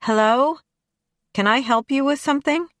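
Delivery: calling up, high and bright. A narrator's reading. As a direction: call down from a window. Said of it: "shouted down to them from my window".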